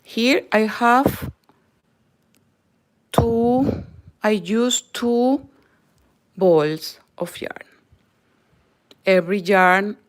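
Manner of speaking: usual speaking voice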